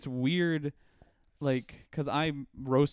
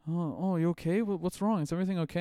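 higher-pitched mock concern; imitated worried girlfriend; 6 semitones higher than usual. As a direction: lightly voice a fussing girlfriend, pitched up. Retells girlfriend's worried fussing.

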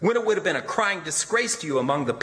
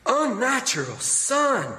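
Unnatural much higher, sharp and appalled; shocked outcry; 10 semitones higher, slower than usual. A narrator's reading. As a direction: rise up in horror. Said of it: "Eliza whispered".

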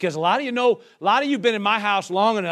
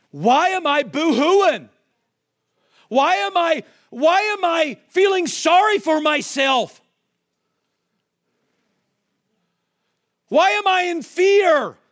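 rising preacher's cry, slow and higher; preaching at full force; 7 semitones higher, 3 dB louder than usual. Acted. Preacher stirring the church into fervent questioning. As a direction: press the congregation, building fervor.

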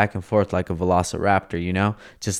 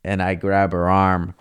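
ordinary delivery

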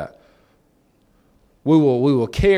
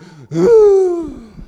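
gasp; 8 dB louder than usual. Retold or imitated. Child finds parent.

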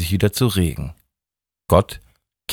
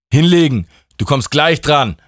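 louder, higher snapped order; sharp command; 7 semitones higher, 3 dB louder than usual. Acted. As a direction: bark him back down. God orders Justus down. (scharfer Befehl, lauter)

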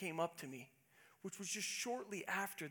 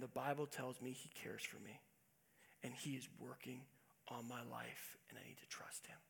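hushed sermon close; 5 semitones lower, 10 dB quieter than usual. Acted. Pastor lowers voice to intimate close.

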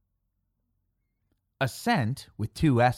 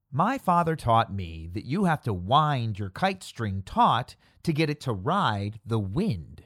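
ordinary talk, nothing marked

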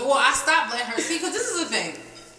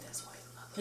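mocking whispered tease; 19 dB quieter, faster than usual. Sister teasing, quoted by S0.

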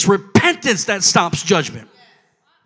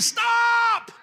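high, drawn-out cry of stop; long high-pitched warning cry; screamed warning; 12 semitones higher, 8 dB quieter than usual. Acted. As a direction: call out to halt a child in danger. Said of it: "you're gonna scream".

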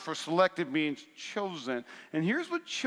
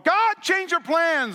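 high, loud, slow whiny plea; mock pleading demand; 12 semitones higher, 7 dB louder, slower than usual. Pastor mimics believers begging God to change plans, high slow whine. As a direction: drag it out like a child begging.

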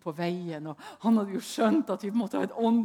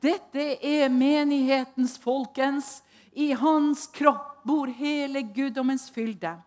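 slow, louder preaching proclamation; 3 semitones higher, 5 dB louder, slower than usual. Acted. Preacher proclaiming scripture to the congregation, slow and louder. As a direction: proclaim each phrase slowly to the church.

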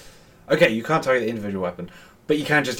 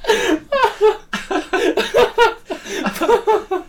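laughing; 4 dB louder than usual. Real, not acted. Laughing fit.

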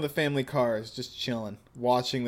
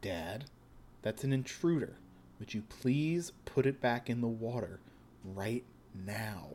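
put-on hushed, cold young man; quiet menacing son; 2 semitones lower, 8 dB quieter than usual. Joshu voice again.

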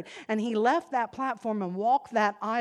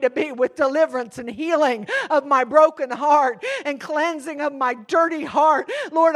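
crying out to the Lord, much louder and higher; loud desperate prayer; 6 semitones higher, 11 dB louder than usual. Acted. Preacher modelling real prayer to congregation, loud and pleading. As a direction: shout it like a desperate plea. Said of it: "Start out right where you're living".

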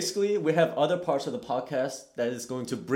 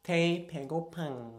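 cute aegyo; 3 semitones higher, 5 dB quieter than usual. Retold or imitated. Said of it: "I want you to show us first".

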